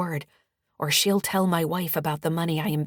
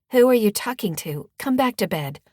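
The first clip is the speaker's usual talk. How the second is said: loud, higher-pitched nagging wife; grating wife; 6 semitones higher, 7 dB louder than usual. Acted. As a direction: call out sharply from the bedroom. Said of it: "his wife's grating sound".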